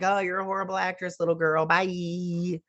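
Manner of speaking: high, teasing singsong put-down; mock the little girl in a high voice; mocking baby talk. Mocking child actress in high voice.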